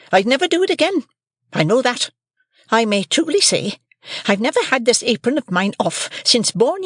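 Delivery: shrill high shrewish scold; scolding sister. A narrator's reading. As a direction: Mrs Joe scolds young Pip, high and shrill.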